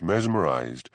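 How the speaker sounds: plain reading voice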